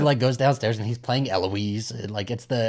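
ordinary talk, nothing marked

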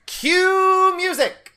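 high, slow sung-out cue; announcer call. Pretend. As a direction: belt it like a show host. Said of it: "the third time I've done it".

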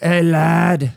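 loud, high mock British greeting; bad British accent. Showing what Brits call bros, mock British accent. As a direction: holler it like a hammed-up British lad. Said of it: "my British accent is really terrible".

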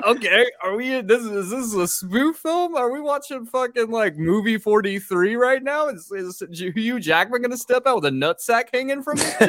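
high, disbelieving rant; baffled moviegoer asking if it's a spoof; incredulous viewer. Retold or imitated. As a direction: pitch up in mock disbelief. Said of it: "It was like".

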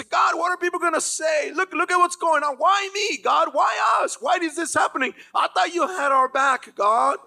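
high, loud whining cry; whining complaint. Retold or imitated. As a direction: complain to God like a hurt child, loudly. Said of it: "He's whining, he's complaining".

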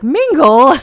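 high, loud appalled question; aghast echo. Retold or imitated. Shy guy told to mingle at a party, high appalled question. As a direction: recoil at the word like it stings.

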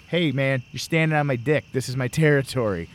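ordinary talk, nothing marked

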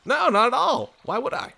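high, bouncy 1920s showman voice; Conan O'Brien old-timey voice. Acted. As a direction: play it like an old-timey radio host. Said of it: "my best Conan O'Brien does up twenties voice".

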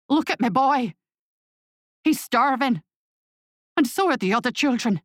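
desperate plea. A narrator's reading. Old man pleads for his son.

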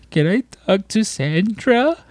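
high, drawn-out tearful wail; mock crying. Podcaster imitates tearful random caller asking for his cousin, mock crying. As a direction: imitate a stranger crying down the phone. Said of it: "she's crying, and she's just like".

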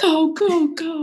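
high urgent go go go; a mock panicked yell to drive; retold yell. Retelling screaming while escaping the dogs, mock yell. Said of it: "we both were just screaming".

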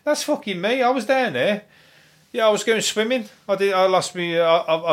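high-pitched, put-on bloke voice; comic high voice of a bloke owning up; indignant bloke. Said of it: "There's a bloke walking around out there, and he's going".

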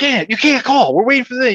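high, loud exasperated brother; imitated yell. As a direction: yell the caller off the line. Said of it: "he would yell at them".

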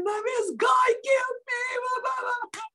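very high-pitched mock scream; retold screaming. Wife retelling her mad morning rant to husband, screaming. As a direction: shriek an accusation, tailing into blah-blah. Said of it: "I was screaming".

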